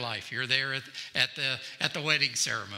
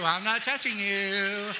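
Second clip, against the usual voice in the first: teasing sibling; 5 semitones higher than usual. Retold or imitated. Brother taunting sibling.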